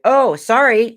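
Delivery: put-on high-pitched kid saying sorry; apologetic kid. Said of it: "as, like, a twelve or thirteen-year-old kid".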